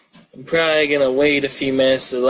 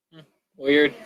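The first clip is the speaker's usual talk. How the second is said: ordinary talk, nothing marked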